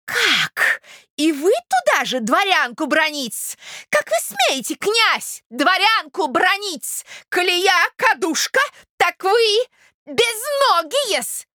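high, slow, outraged rebuke; affronted lady, scolding. Acted. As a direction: scold a prince for the insult. (оскорблённая дама, высоко и гневно отчитывает)

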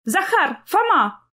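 high calling out across a room. A narrator's reading. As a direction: call the boys from afar. Mother calling twins across hall.

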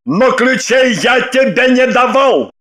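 indignant man's yell. A narrator's reading. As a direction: shout your denial in outrage. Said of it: "и завопил". (возмущённый вопль)

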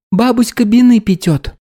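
put-on high lisping little child; toddler lisp. A narrator's reading. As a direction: babble it like a toddler.

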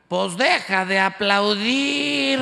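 loud, slow heckler yell; shouted heckle. Retold or imitated. Mexican fan shouting at the singer, loud and slow. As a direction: bellow it from the stands. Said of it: "Le grita un mexicano".

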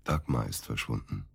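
slow, low and soft, heavy news; grave and quiet. Acted. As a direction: let it drop slowly, hushed. (langsam, leise und schwer die schlimme Nachricht überbringen)

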